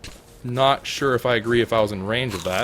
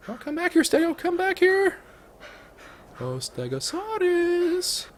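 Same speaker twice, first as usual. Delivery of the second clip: very high sing-song calling Stego back; high sweet calling after a runaway pet; high pleading call; slower than usual. Pretend. Begging the fleeing dinosaur.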